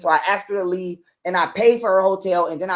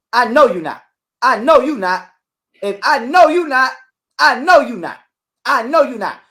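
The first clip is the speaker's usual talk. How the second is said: louder, higher, hammering I know you not; emphatic repetition; 4 semitones higher, 6 dB louder than usual. Retold or imitated. To his ex, repeating the line loud and insistent. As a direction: repeat it like a warning, louder each time.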